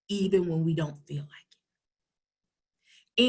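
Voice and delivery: dropped to a whisper. Real, not acted. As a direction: let it fall to a hush. Emphatic closing point.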